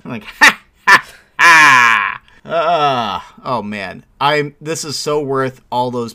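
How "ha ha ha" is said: evil chuckle. Retold or imitated. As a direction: cackle like a cartoon villain. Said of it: "she's laughing like a supervillain".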